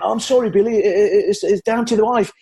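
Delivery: [name]. Retold or imitated. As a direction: backpedal as the flustered star, imitated. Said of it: "[name]'s actually backpedaling and apologising".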